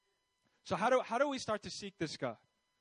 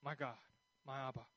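much softer and lower, tender; hushed, close personal prayer; intimate prayer; 7 semitones lower, 12 dB quieter, faster than usual. Retold or imitated. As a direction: murmur it to God like a child to a father. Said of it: "And I'll just be like".

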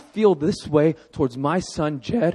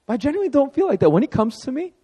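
normal talking voice

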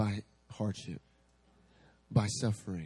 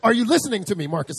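higher, louder pressing question; insistent prophet; 9 semitones higher, 10 dB louder, faster than usual. Retold or imitated. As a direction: demand his attention. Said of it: "He's like".

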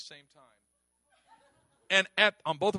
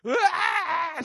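mock wail; 10 semitones higher, 9 dB louder than usual. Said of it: "She starts wailing and bawling".

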